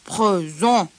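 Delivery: low lion voice, mouth barely open; lion's murmur. A narrator's reading. Lion hiding his teeth from the teacher, voiced murmur. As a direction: answer through nearly closed jaws, not hushed. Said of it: "répondit le lion dans un murmure en ouvrant le moins possible ses mâchoires".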